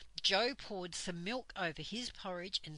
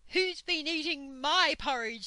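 raised, louder, indignant bear voice; angry Father Bear; 7 semitones higher, 7 dB louder than usual. A narrator's reading. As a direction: demand it as an outraged papa bear. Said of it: "said Father Bear angrily".

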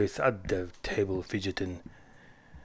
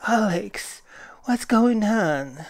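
sleepy voice; 8 semitones higher, slower than usual. Acted. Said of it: "A sleepy voice".

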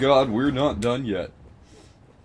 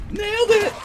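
triumphant squeal; 8 dB louder than usual. Acted. Triumphant squeal after kill.

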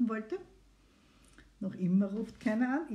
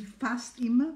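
"fast" drawn out and louder; stress on "fast"; 4 dB louder, slower than usual. Real, not acted. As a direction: lean hard and slowly on the word "fast". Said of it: "Betonung liegt auf fast". (Betonung auf „fast“, gedehnt gesprochen)

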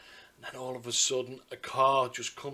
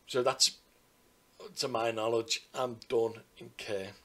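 usual speaking voice

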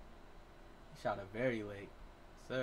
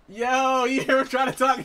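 excited laughing shout; 12 semitones higher, 12 dB louder than usual. Real, not acted. Laughing at teammate's accent on mic.

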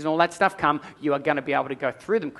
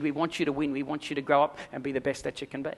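ordinary talk, nothing marked

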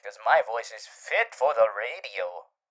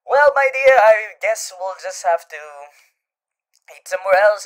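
showman flourish; 6 semitones higher, 10 dB louder than usual. Acted. Cheerful showman decision.

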